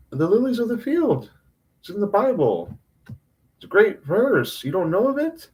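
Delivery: high-pitched pleading disbelief; incredulous. Retold or imitated. Retelling the lilies verse to clueless friends, high incredulous voice. Acting out his baffled exchange with friends.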